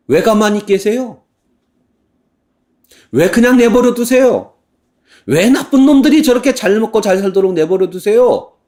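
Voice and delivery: high, loud indignant pleading; heated complaint. Acted. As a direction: demand God punish the wicked. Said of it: "하박국이 하나님께 따지는 건".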